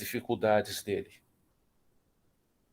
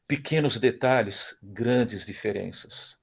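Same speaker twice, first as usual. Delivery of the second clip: slow punchline; slower than usual. Real, not acted.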